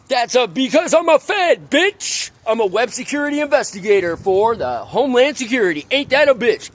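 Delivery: high, heated bragging; defiant boast. Real, not acted. Boasting of being a Fed.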